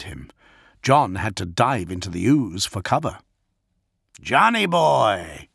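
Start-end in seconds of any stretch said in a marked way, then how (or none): 4.2-5.5 slow, higher taunting call; mock yell. A narrator's reading. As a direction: call out teasingly mid-gunfight. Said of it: "Damon yelled".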